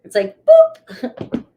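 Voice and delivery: squeaky high boop; a goofy high-pitched boop; silly boop. Acted. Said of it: "I'm so corny".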